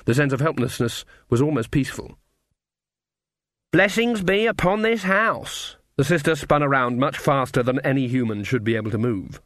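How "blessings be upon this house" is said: high, slow proclaimed blessing; beggar woman act. A narrator's reading. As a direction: hail the house in a put-on high voice. Witch announces herself in disguise.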